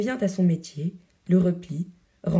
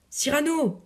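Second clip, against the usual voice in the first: mild, high-pitched cry of his name; alarmed cry; 8 semitones higher than usual. A narrator's reading. Roxane runs to fainting Cyrano, alarmed high cry. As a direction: call out in alarm, without much volume. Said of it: "en criant".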